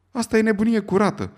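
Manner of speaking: high, loud outraged exclamation; exclaiming. A narrator's reading. Stewart to Fogg over the wager, loud high exclamation. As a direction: burst out at the madness of it. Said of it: "exclamă Andrew Stewart".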